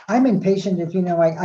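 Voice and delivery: usual speaking voice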